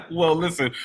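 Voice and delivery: laughing it off, pitch jumping up; laugh, then start talking through it. Real, not acted. Laughs after joke reaction.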